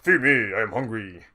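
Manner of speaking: hungry belly voice; talking stomach. Acted. Player reprising his stomach's plea, funny talking-stomach voice. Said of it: "a funny voice to imagine your stomach talking in".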